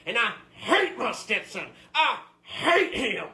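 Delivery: slow, emphatic angry rant; bitter ranting. Acted. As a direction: lean hard on each hateful word. Customer rants about his hated stepson.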